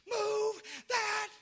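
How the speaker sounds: high-pitched mock yell at the TV; retold scream at the TV. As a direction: yell it like the couch crowd. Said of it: "we scream".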